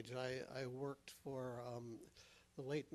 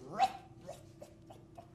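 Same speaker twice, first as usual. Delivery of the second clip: dog barking; 5 dB louder, faster than usual. Acted.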